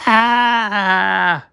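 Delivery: short high yelp of fright; startled scream. Acted. Chopper yelps as the painting grabs him, startled scream. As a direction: yelp as something grabs you.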